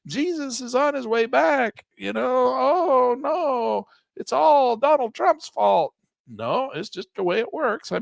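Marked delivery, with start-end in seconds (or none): 0.0-5.9 high, slow hammed-up wail of doom; mock panicking investor. Mocking viewers who panic as the market falls, high hammed-up wail. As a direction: play the hysterical investor who thinks it's the end. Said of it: "yelling and screaming".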